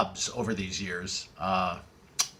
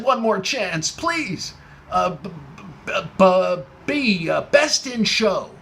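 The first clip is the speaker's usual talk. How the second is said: higher, stuttering, slow desperate plea; pleading stammer; 5 semitones higher, 3 dB louder, slower than usual. Retold or imitated. Caller begging the host for one more chance, stammering. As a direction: beg and stumble over the letter.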